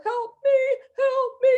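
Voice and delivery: very high, sobbing cry for help. Acted. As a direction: scream for help through tears. Victim crying for help.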